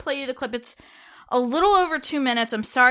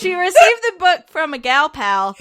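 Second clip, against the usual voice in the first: higher, louder mock reading through laughter; laughing; 5 semitones higher, 7 dB louder than usual. Real, not acted. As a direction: read it out giggling, pitched up and loud. Rereads quote with joke word, laughing.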